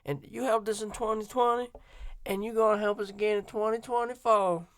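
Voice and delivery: mocking Harris in a put-on Southern accent; Kamala Harris southern accent. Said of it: "Kamala Harris saying shit with a southern accent".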